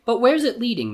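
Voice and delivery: high-pitched servant voice; eager servant. A narrator's reading. Servant prompting Jafar about his scheme, eager.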